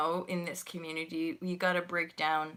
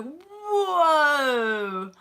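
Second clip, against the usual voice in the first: mock surprise; 7 semitones higher, 8 dB louder than usual. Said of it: "he was like".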